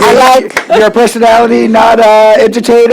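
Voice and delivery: loud, fast and high; excited shout. Real, not acted.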